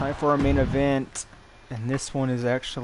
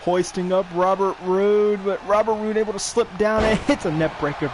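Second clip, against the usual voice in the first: excited play-by-play; 6 semitones higher, 5 dB louder than usual. Real, not acted. Live call of a big move.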